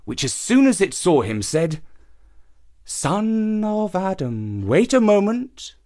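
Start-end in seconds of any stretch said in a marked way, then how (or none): 2.8-5.8 slow, drawn-out snake voice; great snake. A narrator's reading. Snake in the well calls the youth, slow and drawn out. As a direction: stop him with a slow call.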